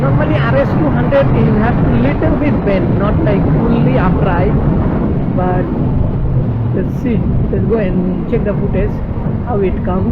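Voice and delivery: shouting above the wind on a ride; loud over wind. Real, not acted. Riding fast, speaking over wind.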